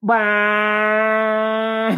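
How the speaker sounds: high, long held bawl; mock child's wail. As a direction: wail like a fallen toddler. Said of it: "then the kid goes".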